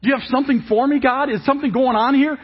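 high, loud whoa wait a minute; astonished realization. Shown as a demonstration. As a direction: burst out in sudden awe. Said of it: "Instead of going".

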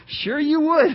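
laughing it out, pitch high; amused disbelief through laughter; chuckling while speaking. Real, not acted. Laughing at son's remark.

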